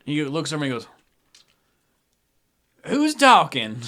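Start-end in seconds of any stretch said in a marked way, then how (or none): 2.8-3.8 put-on startled drunk, high and loud; Crazy Eddie. Drunk looks over at whoever greeted him, loud confused voice. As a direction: call out like a confused town drunk. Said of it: "Crazy Eddie is the town drunk".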